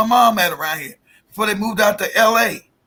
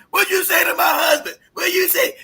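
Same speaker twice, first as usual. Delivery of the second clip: imitating yelling wife; 7 semitones higher than usual. Wife to husband's brother, panicked yelling. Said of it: "She started yelling at me".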